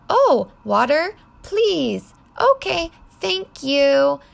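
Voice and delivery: put-on high, loud sing-along toddler-teaching voice; modelling for toddlers. Shown as a demonstration. Modelling polite words for toddlers.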